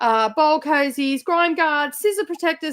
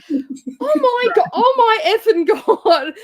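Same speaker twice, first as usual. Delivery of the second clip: slow, higher and louder exasperated cry; mock exasperation; 6 semitones higher, 5 dB louder, slower than usual. Texting friends about the rival, slow mock outrage. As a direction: drag it out in disbelief. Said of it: "I'm like".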